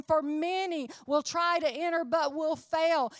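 high, loud, insistent sermon delivery; impassioned preaching. Real, not acted. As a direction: preach it out, pressing every phrase on the congregation. Preaches with raised force.

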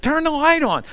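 high, pressing command in the dark; urgent request. Retold or imitated. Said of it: "So I said".

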